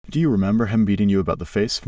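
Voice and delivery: ordinary delivery, nothing marked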